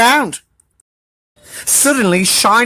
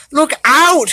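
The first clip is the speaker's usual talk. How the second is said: high, loud warning call; 9 semitones higher, 3 dB louder than usual. Acted. Littlest Rabbit warning of the rolling hedgehog, high call. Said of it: "he called".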